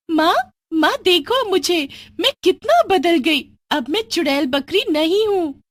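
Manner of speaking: showing off her new look; overjoyed girl. Acted. Girl shouting to mother after transforming, high joyful voice.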